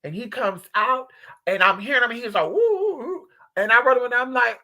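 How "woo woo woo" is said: calling out woo. Retold or imitated. Husband calling dog.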